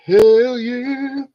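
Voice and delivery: loud, pumped-up cheer; triumphant cheer. Real, not acted. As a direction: shout it out in triumph. Triumph at finished sorting.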